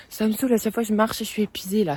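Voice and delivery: exhausted and fed up, blurting it out; fed-up outburst. Real, not acted. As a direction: vent your frustration at full volume, fast. Venting about wasted flyer work.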